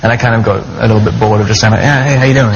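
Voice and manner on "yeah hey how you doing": put-on American accent. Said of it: "In an American accent for some reason".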